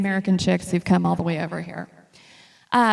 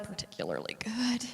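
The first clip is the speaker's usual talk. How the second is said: whisper; 12 dB quieter than usual. Real, not acted. Confiding about the bad food.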